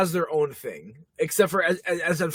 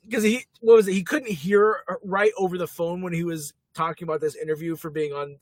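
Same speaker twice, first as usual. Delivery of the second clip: ordinary delivery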